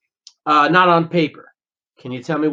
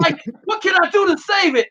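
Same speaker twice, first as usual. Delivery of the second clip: higher, flustered mock panic; 11 semitones higher than usual. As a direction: play a nervous interviewee losing it. Imagined panicked self in interview.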